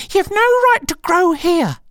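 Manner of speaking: very high squeaky protest; indignant Dormouse. Acted. Dormouse scolding growing Alice, high indignant squeak.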